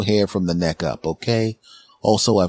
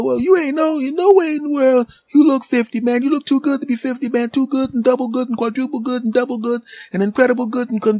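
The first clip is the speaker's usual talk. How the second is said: high, loud excited clerk; store clerk voice; 5 dB louder than usual. Retold or imitated. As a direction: play the hyped-up shop guy calling out.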